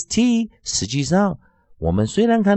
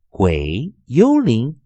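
usual speaking voice

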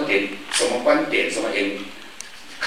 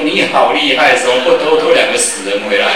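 mocking villagers; 7 dB louder than usual. Villagers mock the boy.

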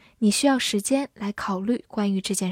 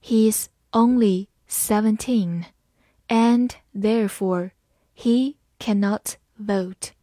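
slow, careful classroom read; slow teacher demo; slower than usual. Shown as a demonstration. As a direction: read it slowly phrase by phrase. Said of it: "我们把第一句慢慢来读".

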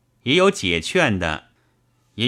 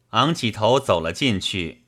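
usual speaking voice

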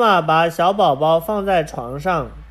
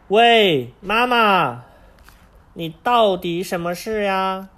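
loud, high call; 4 semitones higher, 3 dB louder than usual. Acted. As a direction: call her from afar. Child calls out to mother.

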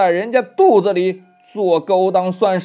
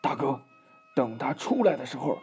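much softer and lower; furtive undertone; 3 semitones lower, 10 dB quieter than usual. A narrator's reading. Third demon secretly advises his brother, hushed. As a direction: drop to a secretive hush. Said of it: "悄悄地对老魔说呀".

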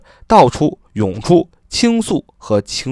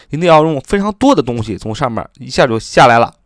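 ordinary talk, nothing marked

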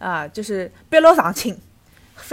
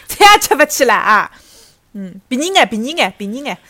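haggling customer; 2 semitones higher, 7 dB louder than usual. Pretend. Customer bargaining role-play.

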